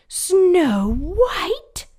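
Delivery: shrieked high and loud. Acted. Jealous queen shrieking at the mirror. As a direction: shriek the name in outrage. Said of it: "shrieked the queen".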